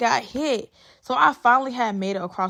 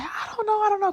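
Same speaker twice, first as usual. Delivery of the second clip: scared denial; 13 semitones higher, faster than usual. Retold or imitated. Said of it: "I'm just like".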